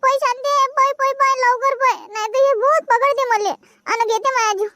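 high, panicked begging to run; terrified pleading. Acted. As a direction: cry for God to save her. Fleeing ghost, pleading. (घाबरून देवाचा धावा)

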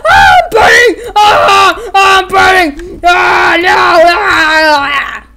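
very high, loud screaming in agony; burning screams. Retold or imitated. Toy character shrieking while burning. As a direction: scream as if on fire. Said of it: "He was like".

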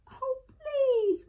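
high, pleading whimper. A narrator's reading. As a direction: whimper it like a scared child. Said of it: "whimpered Eddie".

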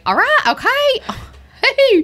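mock her own old Texas twang, high and giggly; mock Texas accent. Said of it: "thick Texas accent".

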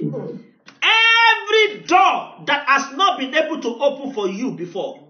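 loud, high, drawn-out decree that swells into song; full-force preaching decree. Acted. Preacher decreeing open doors over his congregation, loud and sung. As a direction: call it down on them like thunder.

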